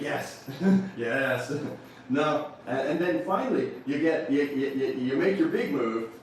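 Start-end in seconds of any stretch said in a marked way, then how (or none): 0.0-1.5 laughing yes. Acted. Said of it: "start saying things like".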